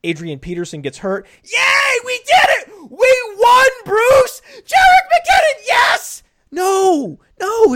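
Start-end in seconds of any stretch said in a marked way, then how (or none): 1.4-7.7 very high, loud fake cheering; hammed-up victory shout; mock celebration. Mock celebration of injury news.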